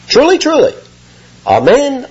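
high, weighty Truly, truly; solemn emphasis. Retold or imitated. Jesus solemnly answering the crowd, weighty emphasis. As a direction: underscore each word gravely. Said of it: "to underscore it".

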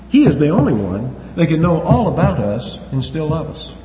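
ordinary delivery

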